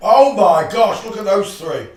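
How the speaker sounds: normal talking voice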